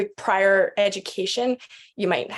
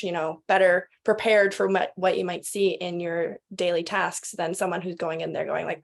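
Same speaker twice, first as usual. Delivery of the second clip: usual speaking voice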